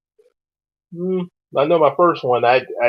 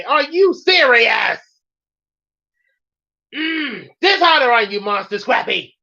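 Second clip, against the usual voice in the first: mock outraged rant; 11 semitones higher than usual. Host ranting at Monster Scrappy, mock outrage.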